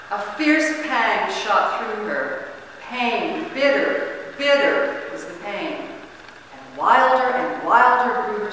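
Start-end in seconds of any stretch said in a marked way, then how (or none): none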